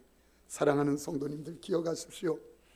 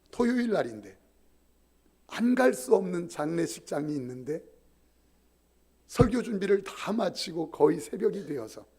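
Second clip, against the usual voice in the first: ordinary delivery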